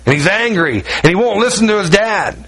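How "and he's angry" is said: ordinary delivery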